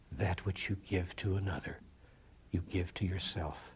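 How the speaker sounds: soft, low, weighty delivery; solemn hush. Real, not acted. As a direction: let a deep truth land quietly. Solemn closing truth after tears.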